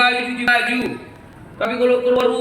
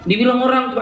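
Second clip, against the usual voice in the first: ordinary talk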